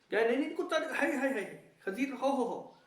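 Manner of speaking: high, laughing mock horror at dog and pork; mock disgusted cries. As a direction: ham up a squeamish listener recoiling. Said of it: "یہاں پہ آوازیں نکالتے ہو".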